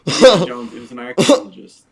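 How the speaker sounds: loud, pushing through the laughter; laughing exasperation, loud; exasperated shout. Real, not acted. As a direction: shout it to be heard over him. Insisting loudly over laughter.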